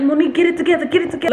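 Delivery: high urgent pleading to herself; panicked self-talk. Retold or imitated. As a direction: snap yourself out of a panic. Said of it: "I'm like".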